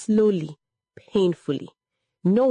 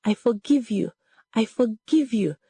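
high, understated grieving cry; voice breaking; 5 semitones higher than usual. A narrator's reading. Daughter forgives her dead mother, mildly breaking voice. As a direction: pitch it up with a touch of heartbreak. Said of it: "Her voice broke".

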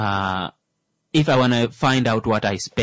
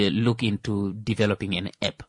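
ordinary talk, nothing marked

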